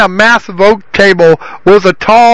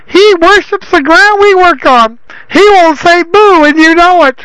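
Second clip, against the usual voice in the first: whiny son voice; 10 semitones higher than usual. Acted. Derek arguing with his father.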